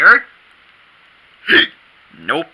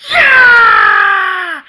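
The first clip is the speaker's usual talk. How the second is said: excited cheer; 8 dB louder than usual. Real, not acted.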